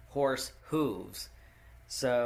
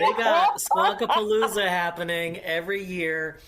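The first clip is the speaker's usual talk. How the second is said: talking through real laughter, loud and higher; laughing; 4 semitones higher, 7 dB louder than usual. Real, not acted. Host who can't help laughing at Squonkapalooza, laughing. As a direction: blurt it out while laughing. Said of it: "I can't help but laugh".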